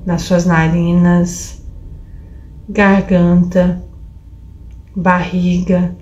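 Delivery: slow, low meditation voice; guided meditation. Acted. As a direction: guide attention gently, slowly. Guides body scan slowly.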